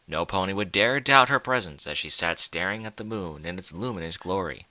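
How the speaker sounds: ordinary delivery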